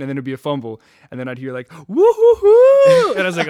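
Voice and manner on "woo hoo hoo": very high, loud drawn-out woo-hoo; Troy's celebratory whoop. Retold or imitated. Host mimicking his friend's reaction to a fumble, celebratory whoop. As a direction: whoop it up in imitation, high and long. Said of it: "Classic Troy sounds".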